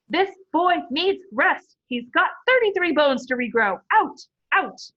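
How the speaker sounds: slow, loud, high shout ordering them out. A narrator's reading. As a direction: nurse shooing the noisy team out.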